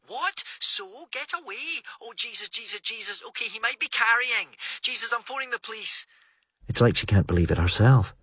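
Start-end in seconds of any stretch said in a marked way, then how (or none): 0.1-6.0 higher, softer, urgent voice down a phone line; frightened, pleading through the receiver; panicking Greer on the phone. Acted.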